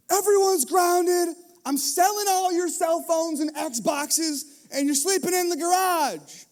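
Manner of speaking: loud, slow, high shouted punishment; slow furious parental shout; angry dad yell. Retold or imitated. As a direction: bellow it out like a fed-up father. Dad blowing up at fighting kids.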